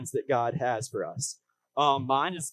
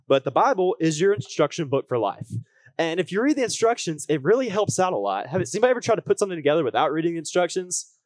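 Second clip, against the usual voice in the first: upbeat youth pastor; 5 dB louder than usual. Acted. Preaching to the congregation.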